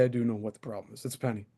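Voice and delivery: ordinary talk, nothing marked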